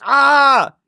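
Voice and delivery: high, loud burst of delight; a real squeal of excitement; a high, loud squeal of joy; excited squeal. Real, not acted. Thrilled at the interview offer.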